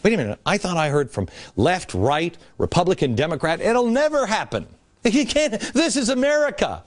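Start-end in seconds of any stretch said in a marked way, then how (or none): 3.6-6.8 high, laughing scoff at the idea; mock disbelief. Mocking the naysayers, high laughing scoff. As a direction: pretend naive certainty, laughing.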